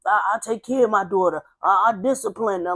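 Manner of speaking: loud, lightly acted boasting; tough Tony. Dad boasting about his daughter, loud mock tough talk. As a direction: brag like a self-styled tough guy. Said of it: "walked around as tough Tony".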